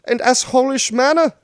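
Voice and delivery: high, loud, lofty and condescending; pompous emperor voice. Acted. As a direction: lecture like an arrogant emperor. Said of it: "then I can go like this".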